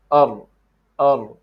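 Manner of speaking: 'Ar' tapped, no throat; tongue-tip R. Shown as a demonstration. Teacher modelling the right sound, tongue-tip R. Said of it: "Don't use anything here".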